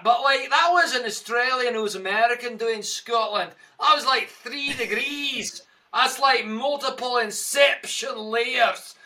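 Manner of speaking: hammed-up Scottish, spitting the percussive sounds; Scottish accent. Shown as a demonstration. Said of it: "Give me something in your Scottish accent".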